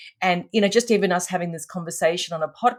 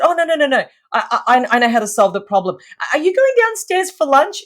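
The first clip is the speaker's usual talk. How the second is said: high, louder, quick reassuring protest; eager pleading; 7 semitones higher, 5 dB louder than usual. Retold or imitated. Pleading with the client in the lift, higher and eager. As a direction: talk her round before she goes.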